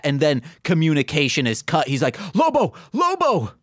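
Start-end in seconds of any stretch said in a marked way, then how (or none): 2.3-3.5 calling out. Retold or imitated. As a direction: yell the name, higher each time. Turbine calls out for Lobo.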